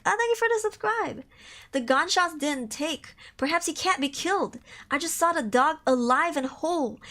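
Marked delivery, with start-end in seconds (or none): 0.1-1.2 high sudden thank you; thanking a viewer. Real, not acted. Streamer thanking a new subscriber, high voice.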